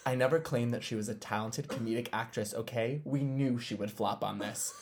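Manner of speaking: quiet, even reading of her own text; calm and sober. Retold or imitated. Host quoting her own calm reply to her friend. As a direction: read it level and composed. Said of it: "very calmly and soberly".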